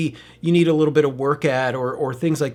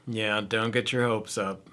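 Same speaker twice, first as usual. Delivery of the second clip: quiet mock discouragement; 5 semitones lower, 8 dB quieter than usual. Repeats discouraging quote.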